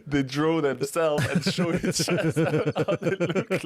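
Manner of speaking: words slowed and stretched by laughter; can barely get it out for laughing; giggling through a funny memory. Real, not acted. Laughing at funny vampire scene.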